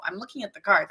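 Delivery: ordinary delivery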